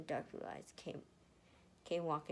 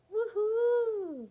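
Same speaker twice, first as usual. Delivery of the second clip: much higher, louder whoop; cheer; 12 dB louder than usual. Real, not acted. Reader, hyping tomorrow's fanfic readings, loud high whoop. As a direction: whoop with glee.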